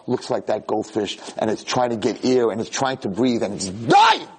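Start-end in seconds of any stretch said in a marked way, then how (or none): 3.7-4.3 sudden loud, high cry; anguished climax. Real, not acted. Rabbi describing a suffocating soul, sudden anguished shout. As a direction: burst out on the word.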